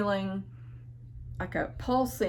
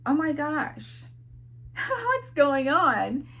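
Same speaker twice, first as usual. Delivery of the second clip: amazed laughing; 6 semitones higher, slower than usual. Retold or imitated. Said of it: "I'm sitting there thinking".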